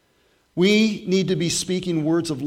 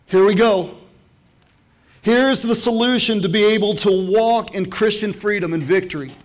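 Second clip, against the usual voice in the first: much higher, louder preached scripture; fiery preaching; 7 semitones higher, 8 dB louder than usual. Acted. Pastor preaching James to the church, at full force. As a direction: thunder the verses at the congregation.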